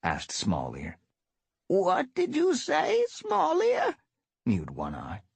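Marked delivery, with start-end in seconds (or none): 1.7-3.9 put-on much higher, slow old cat voice; hard of hearing elder. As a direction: deaf old cat asking.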